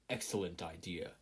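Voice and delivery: put-on masked muscular boy nodding along; big boy voice. A narrator's reading. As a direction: say it low and calm.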